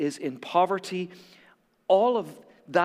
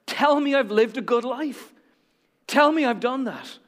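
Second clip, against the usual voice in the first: raised, pleading voice, higher and louder; passionate plea; 7 semitones higher, 4 dB louder than usual. Acted. Old man to his wife at the grave, higher, pleading. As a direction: beg her to tell you it was worth it. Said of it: "sort of passionately he says to her".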